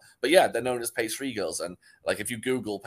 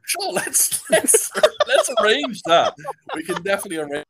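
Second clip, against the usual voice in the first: laughing; 10 semitones higher, 9 dB louder than usual. Real, not acted. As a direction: laugh while agreeing to the joke. Laughing along with a joke.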